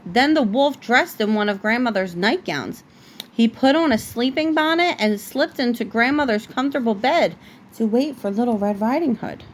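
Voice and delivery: usual speaking voice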